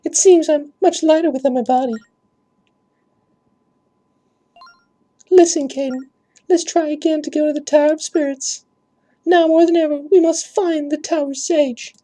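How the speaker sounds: very high, airy put-on princess voice; Zelda ghost voice. Ghost princess begging the guards for help, very high voice. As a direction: float way up into a thin ghostly falsetto. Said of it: "I have to really put it on".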